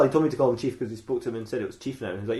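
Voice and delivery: ordinary talk, nothing marked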